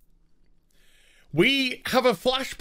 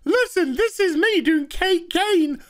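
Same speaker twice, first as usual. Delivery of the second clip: mock silly voice; 9 semitones higher than usual. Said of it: "Mouse was just like".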